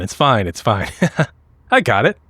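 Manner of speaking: ordinary talk, nothing marked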